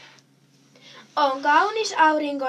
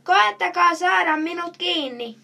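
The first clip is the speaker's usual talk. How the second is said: louder, higher call to pursuers; teasing call; 5 semitones higher, 5 dB louder than usual. A narrator's reading. Chameleon dares the boys to catch him, calling out. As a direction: call out a dare, moderately loud. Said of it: "huutaa Kalle".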